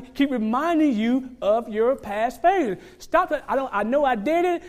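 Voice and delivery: loud, high, driving sermon climax; full-force preaching. Real, not acted. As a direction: preach it out to the whole church.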